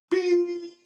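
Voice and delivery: a high, sharp beep for the cut line; mock phone beep. Telling the cohost how the call dropped, high mock beep. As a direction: squeak out the phone cutting off.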